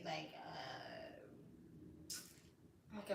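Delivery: whispered filler while thinking. Real, not acted. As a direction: drop to a whisper, searching. Searching for a word.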